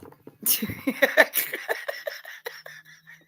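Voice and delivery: breathy high-pitched cackle; high airy laugh. Real, not acted. Streamer laughing at own joke about Nigel, high breathy laugh. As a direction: let out a high wheezy laugh.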